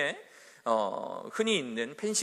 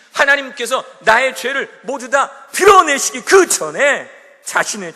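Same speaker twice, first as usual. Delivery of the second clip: preaching that builds to a loud climax; fervent preaching; 5 semitones higher, 13 dB louder than usual. Acted. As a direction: drive the point home to the congregation. Preaching builds to full force.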